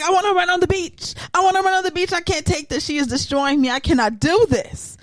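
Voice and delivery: high, fast whiny wail; mock Montoya whining. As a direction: whine like a heartbroken man. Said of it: "He's like".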